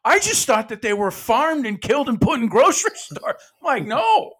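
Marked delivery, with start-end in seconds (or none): 0.0-3.4 high, loud, comic protest; laughing-edged mock disbelief; incredulous. Disbelieving relative voiced in story. 3.9-4.3 high, loud comic no; exaggerated high-pitched correction; emphatic denial. Acted reply to absurd belief.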